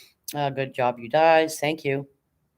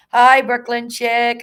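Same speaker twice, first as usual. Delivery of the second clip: excited greeting; 8 semitones higher, 9 dB louder, slower than usual. Real, not acted. Loud greeting to a viewer.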